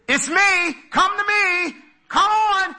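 high, loud calling voice; voice of the master. Acted. Jesus calling to Peter on the water, high and loud. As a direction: call them out of the boat, lifted high. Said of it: "the voice of the master".

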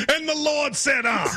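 teasing a friend with a preacher imitation; mock preacher. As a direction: ham up a preacher's sermon cadence. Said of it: "That's what you sound like".

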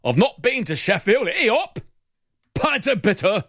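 high, hammed-up British accent. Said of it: "I've suddenly slipped into a British accent".

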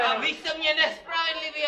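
high, loud yelled protest; loud complaint. Pretend. Wounded trainee yells a complaint at the instructor, loud protest. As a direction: shout the protest back.

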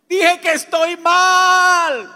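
yelling with release after finally confessing; triumphant shout. Retold or imitated. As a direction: shout it out like a breakthrough.

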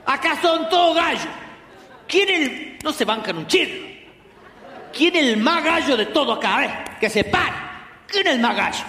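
put-on high, swaggering drunk taunt; drunk challenge. Drunk brother challenging relatives, high swaggering taunt. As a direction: taunt the room like a tipsy tough guy.

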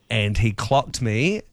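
ordinary delivery

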